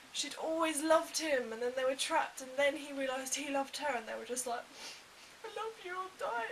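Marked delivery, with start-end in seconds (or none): 5.4-6.5 high, hammed-up lovelorn declaration; mock tragic lovers. Retelling Tosh and Owen's deaths, high mock tragic. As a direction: play the doomed lovers, lightly mocking.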